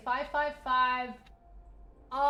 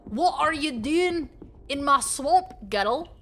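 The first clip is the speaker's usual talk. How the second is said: loud, higher Scottish imitation; Scottish accent; 5 semitones higher, 6 dB louder than usual. Streamer playing with a film line, Scottish accent. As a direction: demand it in a put-on Scottish accent. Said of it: "The way that Scottish people say the word girl".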